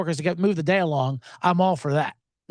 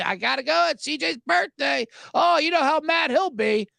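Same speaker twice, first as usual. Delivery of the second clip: mock excuse to family; 10 semitones higher than usual. Telling family he's going out.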